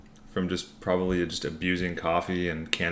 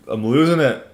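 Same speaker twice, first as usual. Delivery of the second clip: excited outburst; 6 semitones higher, 7 dB louder than usual. Real, not acted. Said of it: "I'm losing it over here".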